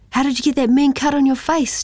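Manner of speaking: put-on high, eager child's question; a young boy bursting with curiosity; excited kid. A narrator's reading. Little brother asking his hurt brother, excited. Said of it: "Timothy asked excitedly".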